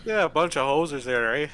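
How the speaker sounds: hammed-up Canadian hoser accent, laughing at the end; bad Canadian accent. Mocking Canadians for the group, bad Canadian accent. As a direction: goof around in a thick fake Canadian accent. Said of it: "my bad Canadian accent there".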